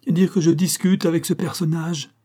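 high, exasperated outcry; desperate cry. A narrator's reading. Academician appealing to monsieur Tarve, desperate cry. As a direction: complain aloud in despair. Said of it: "pour crier désespérément à monsieur Tarve".

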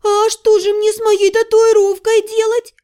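high, plaintive whimper; girl whining about her tattoo; whiny, tearful. A narrator's reading. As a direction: ask it on the verge of tears. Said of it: "спросила плаксивым голосом".

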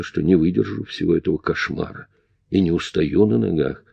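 ordinary delivery, nothing marked

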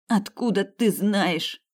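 slow, forced out in pain; strained through pain. A narrator's reading. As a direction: squeeze the words out through pain. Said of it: "выдавила Грит".

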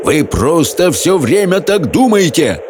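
high, drawn-out outburst; roaring on. A narrator's reading. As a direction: keep roaring, stretching the words. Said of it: "проревел Хоул". (протяжный рёв на высоких тонах)

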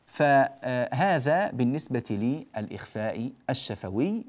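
normal talking voice

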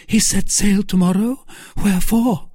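high, loud questioning voice; startled Milady. Acted. Milady alarmed at news of the sailing, high and loud. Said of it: "cried Milady".

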